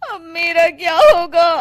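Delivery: slow, high comic lament; hammed-up crying wife; mock wailing. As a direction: wail as if doomed. Wife lamenting her fate.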